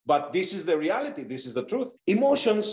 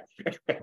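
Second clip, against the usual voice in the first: quick breathy laugh; short leftover laugh; small chuckle; 4 dB quieter than usual. Real, not acted. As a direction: let out a brief chuckle. Trailing laugh after joke.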